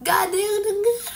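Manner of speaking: high, loud strained complaint; rising, strained cry of frustration; straining to be heard. Shown as a demonstration. Straining demo of not being heard.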